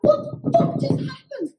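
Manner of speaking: high stunned "what the fuck just happened"; high shocked question; shocked disbelief. Retold or imitated. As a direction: gasp it out in disbelief. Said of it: "and I'm like".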